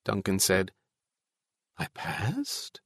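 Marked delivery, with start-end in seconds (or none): 1.8-2.8 soft question rising high; hushed disbelief. A narrator's reading. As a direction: ask it quietly, unable to believe it. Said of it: "He could not believe it".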